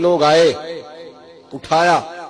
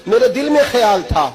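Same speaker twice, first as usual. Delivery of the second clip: high, raised preacher's voice; impassioned retold insistence; emphatic repeat; 6 semitones higher, 4 dB louder than usual. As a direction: push the line up high with force. Mother's thought repeated with force.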